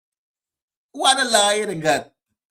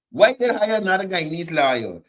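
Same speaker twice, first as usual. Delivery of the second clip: normal talking voice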